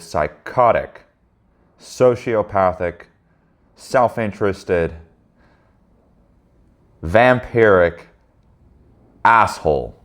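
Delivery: slow, drawn-out list of charges; deliberate accusation. Acted. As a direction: spell out each accusation slowly.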